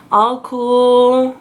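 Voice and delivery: mock cool pose on cool; playing it cool. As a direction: say all cool like you're acting tough. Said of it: "I try to look all cool".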